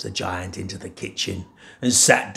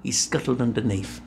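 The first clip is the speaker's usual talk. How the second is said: ordinary delivery, nothing marked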